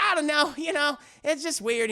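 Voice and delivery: lightly Southern wife, pitched high; Bridget. Retold or imitated. Wife dodges husband's question, high Southern accent. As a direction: brush it off in a high Southern lilt. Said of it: "She has a very deep Southern accent".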